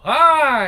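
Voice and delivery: high, loud drawn-out moan; acted moan. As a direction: let out a loud mock moan. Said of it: "her moans intensify".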